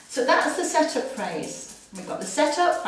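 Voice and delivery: ordinary delivery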